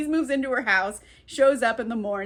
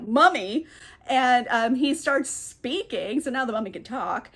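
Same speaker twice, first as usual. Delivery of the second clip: normal talking voice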